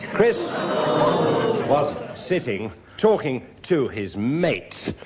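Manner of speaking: ordinary talk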